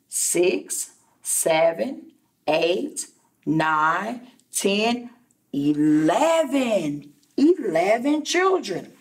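ordinary delivery

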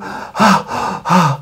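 high, breathless sigh of relief; carrot's breathless sigh. Acted. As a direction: puff out air after hiding. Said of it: "he said, panting".

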